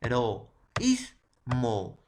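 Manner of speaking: e-ro-ís-mo very slowly, stressing the í; syllabified slowly. Shown as a demonstration. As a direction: split o and í in eroísmo. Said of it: "Igual que la palabra". (eroísmo silabeado muy despacio)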